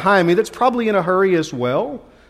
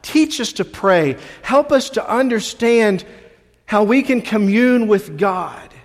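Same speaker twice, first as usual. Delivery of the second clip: prayerful plea; slower than usual. Acted. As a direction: plead gently to the Lord. Prays as the disciples.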